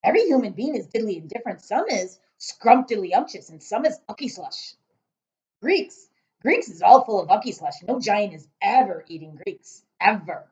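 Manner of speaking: loud booming giant. Acted. Giant ranting about tastes, booming voice. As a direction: boom like a giant.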